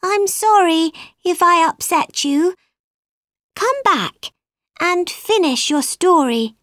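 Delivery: high-pitched, imploring apology; pleading apology. A narrator's reading. Alice to the upset mouse, pleading apology. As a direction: apologise and coax him back, pitched high.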